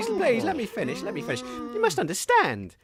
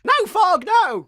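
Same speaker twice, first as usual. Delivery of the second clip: loud, high cry of no; alarmed shout; 9 semitones higher, 8 dB louder, slower than usual. Acted. Jack shouting at Fog to stop, loud high cry. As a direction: yell to stop him lunging.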